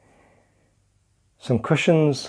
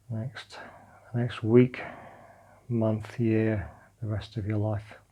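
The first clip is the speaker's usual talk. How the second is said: calm meditation guidance, drifting softer; guided meditation; 3 dB quieter than usual. Acted. Teacher guiding listeners lying on the floor through a body scan, calm meditation voice. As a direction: guide them gently into letting go.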